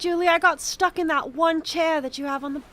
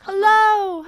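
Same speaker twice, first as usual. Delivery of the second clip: louder call down into the ditch, echoing; loud call into chasm; 4 semitones higher, 12 dB louder than usual. Acted. Denise calling down into the chasm, loud hello. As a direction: call out to find anyone below.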